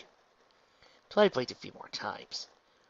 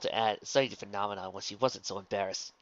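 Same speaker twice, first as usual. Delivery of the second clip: ordinary delivery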